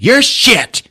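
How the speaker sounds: mock shouted retort. Retelling Leia snapping back at Poe, hammed-up yell. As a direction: bark it back like a petty kid. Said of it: "She's like".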